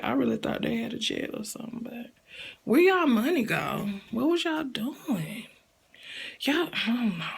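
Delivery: soft muttered aside to self. Real, not acted. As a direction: mumble it under your breath. Muttered aside about money.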